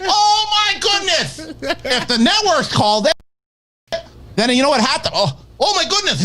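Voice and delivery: very high, theatrical Vizzini outrage; high, dramatic Vizzini voice; Vizzini impression. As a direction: ham up a high, cartoonish Vizzini. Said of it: "He's turned into Vincini from Princess Bride".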